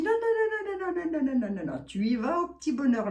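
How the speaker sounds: high run of repeated no's, sliding down; mock-panicky self-correction, starting very high; retold emphatic refusal. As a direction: reel off the no's high, then descend. Retold self-talk, refusing to text.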